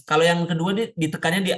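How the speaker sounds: ordinary talk, nothing marked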